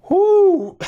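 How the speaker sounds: loud high cheer; joyful whoop. Real, not acted. Gamer cheering the late equalizer, loud whoop. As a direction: let out a real celebratory whoop. Celebrating tying goal.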